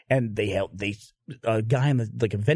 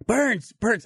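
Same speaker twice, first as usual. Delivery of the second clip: retold yell; 8 semitones higher than usual. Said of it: "he's yelling for me".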